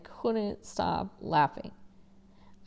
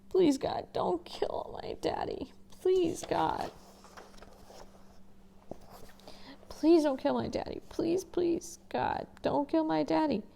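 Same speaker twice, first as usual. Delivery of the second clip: high, breathless whispered pleading to God; desperate prayer; 9 semitones higher than usual. Acted. Boy begging God to spare his father, frightened whisper. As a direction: beg God in a frightened hushed voice.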